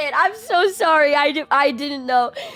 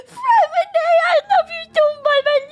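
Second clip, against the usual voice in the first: overjoyed gushing; 9 semitones higher than usual. Real, not acted. Gushing over a beloved character.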